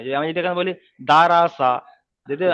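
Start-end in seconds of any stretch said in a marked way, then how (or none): 1.1-1.7 "রা" in দা রা সা as thin open raa. Shown as a demonstration. Teacher showing the wrong reading, "রা" said thin. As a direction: say "রা" light, with a plain long aa. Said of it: "তখন এটা বারিক হয়ে যাবে".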